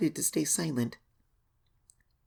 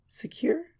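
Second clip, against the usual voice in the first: uneasy whisper; 10 semitones higher than usual. Acted. Hesitant unsure whisper.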